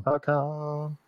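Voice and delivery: high cheesy ad tagline from far back; commercial tagline voice. Acted. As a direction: chime in like a cheesy commercial jingle. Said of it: "That sounds so terrible when you add that to the end".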